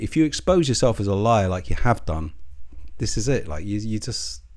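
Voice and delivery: ordinary talk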